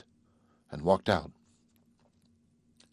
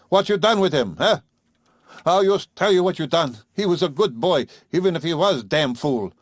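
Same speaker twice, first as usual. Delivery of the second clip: accusing farmer; 6 semitones higher than usual. Acted. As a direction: pour out the grievance like a wronged father. Father keeps accusing her.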